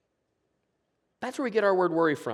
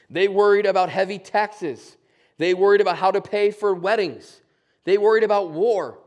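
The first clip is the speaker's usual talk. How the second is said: loud, rising preacher's cadence, each line punched; preacher pressing the point to the congregation; building sermon list; 3 semitones higher, 6 dB louder than usual. Acted. Pastor listing worries, loud and driving.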